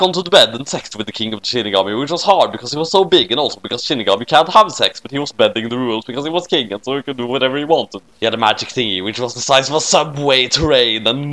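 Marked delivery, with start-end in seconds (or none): none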